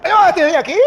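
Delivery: re-enacted bold call. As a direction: sing it out to the pastor. Announces himself to the pastor.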